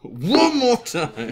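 mock threat.

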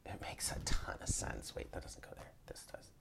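whispered to herself; hushed aside while sorting cards; whispering. Real, not acted.

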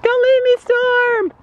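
high, loud pleading horse voice; squeaky, loud, high plea; anxious horse voice. Pretend. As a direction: voice the clingy horse begging not to be left. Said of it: "She's like".

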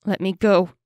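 loud, struggling demand for release; shouted. A narrator's reading. Captive girl fights the elf's grip, shouting. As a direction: shout it, punching up the volume. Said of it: "Janir shouted".

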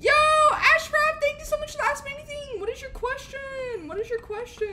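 very high, hyped streamer thanks; excited shout-out. Real, not acted. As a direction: open loud and high, keep it up. Streamer hails a viewer's request.

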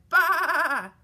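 loud, wavering baa; sheep bleat. A narrator's reading. Sheep greets Little Blue Truck, loud bleat. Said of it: "Sheep said".

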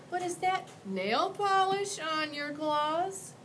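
put-on high, hesitant little boy; a polite kid asking carefully; young boy voice. Boy asks the monster.

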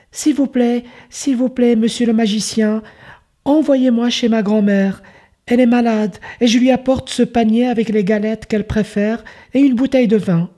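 put-on a sweet child begging politely; little girl voice. Girl asks the magician.

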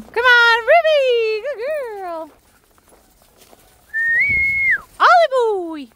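high, drawn-out pet-calling voice; calling dogs. Real, not acted. Walker calls Ruby and Ollie over, high and coaxing. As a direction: call the dogs in with high-pitched praise.

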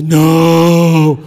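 loud, long bellowed refusal; defiant shout. Retold or imitated. Naughty kid answering against everyone, loud defiant bellow. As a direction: roar it out as the contrary kid.

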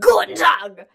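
full-force shouted German greeting; very loud, hammed-up German shout; German yell. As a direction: belt it out in mock German. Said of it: "Just yell it".

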